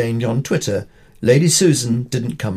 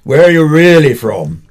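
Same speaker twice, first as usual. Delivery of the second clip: louder blustering demand, stretched where; crusty major's blast; 7 dB louder than usual. Retold or imitated. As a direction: bark the question like an irate old officer. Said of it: "exploded the crusty major".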